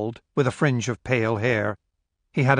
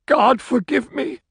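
anguished plea; 13 semitones higher, 3 dB louder than usual. A narrator's reading. Distraught man pleads.

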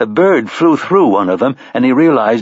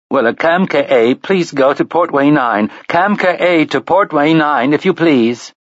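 higher, even robotic PA announcer; automated announcement voice; 5 semitones higher than usual. Acted. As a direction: call it out like a machine station announcer. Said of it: "began to cry in an automated voice".

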